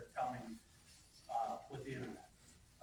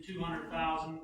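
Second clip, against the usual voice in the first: normal talking voice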